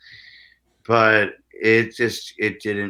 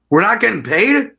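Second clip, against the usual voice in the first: shocked barback; 10 semitones higher, 5 dB louder than usual. Retold or imitated. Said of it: "And he's like".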